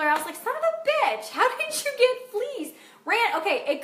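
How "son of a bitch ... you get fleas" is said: much higher, strained outrage at the cat; exasperated curse. Retold or imitated. Coach cursing at her flea-ridden cat, high outrage. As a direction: swear in high-pitched disbelief. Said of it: "excuse the vernacular".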